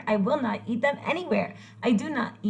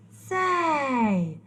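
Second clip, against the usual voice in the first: sharp gasp then a higher say; surprised gasp; 3 semitones higher than usual. Acted. Grump tasting eggs for the first time, surprised gasp. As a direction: gasp in sudden discovery.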